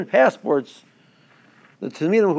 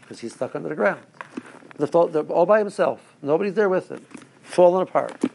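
ordinary delivery

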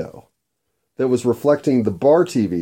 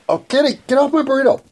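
scolding the cat. Real, not acted. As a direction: shoo the cat off the food.

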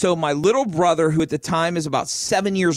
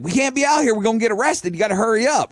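urgent dad; 7 semitones higher, faster than usual. Retold or imitated. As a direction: rush him along, worried. Dad urging son from boat.

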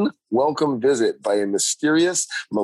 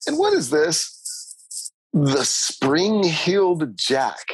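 slow, higher spooky voice; dramatic spooky reading; 3 semitones higher, much slower than usual. Acted. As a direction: draw it out like a ghost story.

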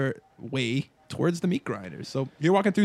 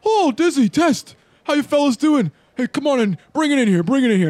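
high, loud, exuberant holler; hearty yelled greeting; 13 semitones higher, 8 dB louder than usual. Acted. Glenn greets his friends and calls them in for a hug, yelling. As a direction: yell out a big-hearted hello. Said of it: "And he yells".

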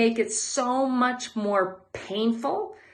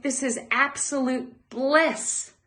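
slow, higher, savoring each word; blissful; 5 semitones higher, slower than usual. Retold or imitated. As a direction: sigh it out to your wife in pure contentment. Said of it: "and say to my wife".

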